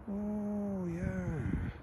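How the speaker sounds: normal talking voice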